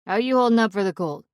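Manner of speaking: chatty put-on Pittsburgh accent. Narrator asking Huck on the radio, in a Pittsburgh accent. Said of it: "in my best Pittsburgh accent".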